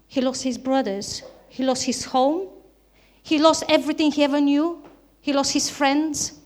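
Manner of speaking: usual speaking voice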